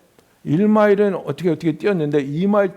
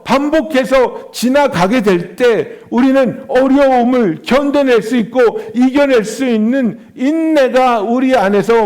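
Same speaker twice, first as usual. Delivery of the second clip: raised, emphatic sermon voice; preaching; 5 semitones higher, 4 dB louder, slower than usual. Real, not acted. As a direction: drive each phrase home to the congregation. Preaches on suffering and endurance. (힘주어 설교하는 목소리)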